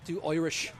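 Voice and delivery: "Irish" said as "Oirish" in an Irish accent. Shown as a demonstration. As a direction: put on an Irish accent, "Oi" opening "Irish". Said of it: "hear me do".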